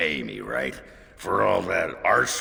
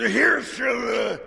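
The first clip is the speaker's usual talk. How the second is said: put-on loud drunk toast; 5 dB louder than usual. Drunk toast, louder retry.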